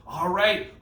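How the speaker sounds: normal talking voice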